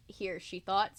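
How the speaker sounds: normal talking voice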